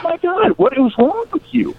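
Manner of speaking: high incredulous outcry; exasperated. Retold or imitated. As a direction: voice your disbelief at the cruelty. Narrator's exasperated outburst.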